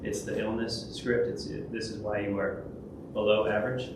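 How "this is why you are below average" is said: usual speaking voice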